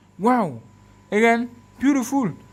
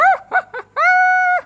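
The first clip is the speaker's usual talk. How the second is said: a high burst of laughter; delighted laugh; 5 dB louder than usual. Real, not acted. Pleased with the photo shown to viewers, a high delighted laugh.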